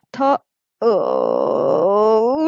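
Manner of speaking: a low wavering sob that breaks the sentence off; acted sob. Xixi bursting into tears before the teacher, low wavering sob. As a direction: let the words dissolve into a low sob. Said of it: "西西忍不住哭了出来了".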